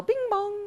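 high sung two-note doorbell; doorbell chime. Acted. Duck rings at the castle door, chiming doorbell noise. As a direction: imitate a ringing doorbell in a high voice.

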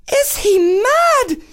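high slow outraged shout; shocked yell. Acted. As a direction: scream it in outrage. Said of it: "yelled the princess".

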